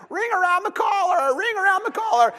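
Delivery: high, shouting demonic voice; possessed devil voice. Retold or imitated. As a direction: shriek it like a possessed shirt. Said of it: "possessed devil voice comes out of the shirt".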